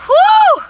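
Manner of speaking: loud, very high "whew"; relieved whoop. Real, not acted. Mover finally coming up for air, relieved high whew. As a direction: let out a big high-pitched whew. Relief after a whirlwind move.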